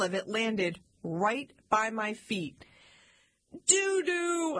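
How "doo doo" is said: high, drawn-out mock scream; little kid's alarm cry, narrated without full volume; screamed warning. A narrator's reading. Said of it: "I screamed".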